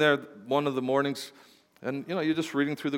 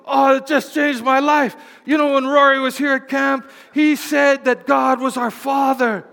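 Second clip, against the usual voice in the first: high, loud, breathless gushing; mock gushing visitor; 10 semitones higher, 8 dB louder than usual. Pastor imitating a visitor telling him, high loud gushing. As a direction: gush like a newly enlightened visitor. Visitor excitedly retelling revelation.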